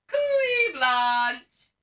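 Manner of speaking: loud, high "Cooey" swooping down, then "Blanche!"; audience reply call. Shown as a demonstration. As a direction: show the crowd how to call back. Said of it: "shout back like this".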